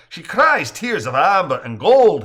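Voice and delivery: playful Irish brogue, showing off. Acted. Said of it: "he adds in an Irish brogue".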